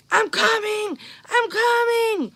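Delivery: high, stretched call from a distance; a father shouting as he runs toward his child. Retold or imitated. Dad calls out as he runs to his screaming daughter. Dad crashing through the brush.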